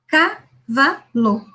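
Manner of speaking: Cavalo stretched slowly, one syllable at a time; syllable-by-syllable reading. Shown as a demonstration. Teacher showing a child reading syllable by syllable, slow. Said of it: "um símbolo para cada sílaba".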